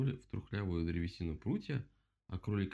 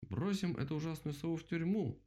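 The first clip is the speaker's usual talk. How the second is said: high, rallying cry; exclaiming; 9 semitones higher than usual. A narrator's reading. Rabbit calls for jailing the owl, exclaiming. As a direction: proclaim it to rouse the crowd. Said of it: "воскликнул кролик".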